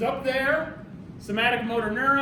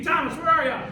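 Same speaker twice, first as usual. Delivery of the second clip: louder, higher mock summons; playful calling out; 4 semitones higher, 6 dB louder than usual. Teacher calling Thomas for more ATP, playful shout. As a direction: call out for a missing helper.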